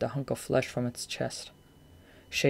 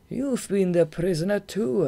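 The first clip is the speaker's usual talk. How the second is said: raised, knowing remark; 5 semitones higher, 4 dB louder than usual. A narrator's reading. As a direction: Goth noticing Marina's band, knowing remark. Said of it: "Goth remarked".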